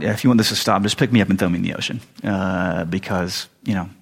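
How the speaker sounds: low, offhand mock-Jonah answer; nonchalant. Acts Jonah shrugging at the storm.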